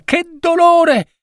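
very high, drawn-out wail; girl wailing with sudden pain; cry of pain. A narrator's reading. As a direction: stretch it out in high-pitched agony. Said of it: "gridò la ragazza".

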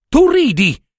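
imitating his wife's high, loud rebuke; high mock scold of the wife. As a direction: snap it like a nagging wife. Anselmo quotes his scolding wife.

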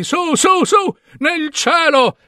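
high, drawn-out climbing voice; rising upward. A narrator's reading. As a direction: lift the voice up and up into the sky. Narrator mimics rising into the sky.

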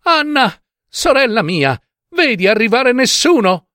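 high-pitched anxious wife calling up. A narrator's reading. Wife calls to her sister on the tower, anxious. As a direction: call up to your sister, high and pleading. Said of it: "le gridava di quando in quando".

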